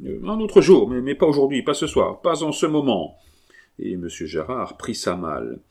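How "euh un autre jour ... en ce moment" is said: much higher and louder, frantic; terrified man fending off the monk; panicked refusal. A narrator's reading. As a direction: beg off in desperation.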